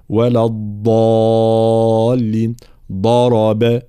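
long heavy ض in الضالين and ضرب; tongue-edge ض demo. Shown as a demonstration. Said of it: "الضاد الغير مشالة والتي تخرج من حافة اللسان". (نطق الضاد من حافة اللسان في «الضالين» و«ضرب»)

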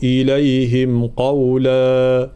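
slow, chanted isti'adha; Quran recitation. Shown as a demonstration. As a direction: recite slowly for the class to repeat. (تلاوة بطيئة للتلقين)

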